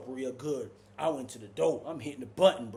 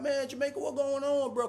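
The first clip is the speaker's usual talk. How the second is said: calling out; 11 semitones higher than usual. Retold or imitated. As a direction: shout through the doors. Inmates calling out.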